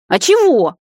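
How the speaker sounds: high, loud wounded protest; offended grumble. A narrator's reading. Brother shooed off the bed, offended. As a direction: protest as if wrongly accused. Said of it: "обиженно буркнул Кай".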